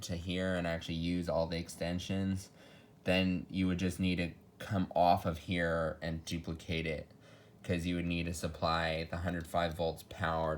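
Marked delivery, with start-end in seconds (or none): none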